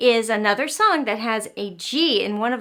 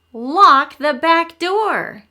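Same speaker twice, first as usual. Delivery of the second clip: slow and deliberate, each word pressed; emphatic moral; 3 semitones higher, 8 dB louder, much slower than usual. Shown as a demonstration. Teacher to children, slow emphatic moral. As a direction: lay down the lesson slowly. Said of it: "the moral for dinosaurs is".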